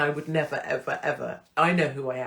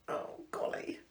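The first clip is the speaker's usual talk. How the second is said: choked whisper; 11 dB quieter than usual. Real, not acted. Crying over letter.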